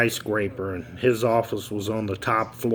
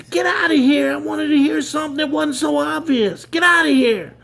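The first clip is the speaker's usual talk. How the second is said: much higher, louder enraged yelling; angrier blow-up; 4 dB louder than usual. Acted. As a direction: explode at the kid even harder. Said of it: "C E O blows up again".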